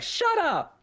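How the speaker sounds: put-on very high little-kid squeal of a retort; imitated little girl. Young niece copying her aunt, high-pitched little girl imitation. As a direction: parrot it back in a tiny high child's voice. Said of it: "the little girl was like".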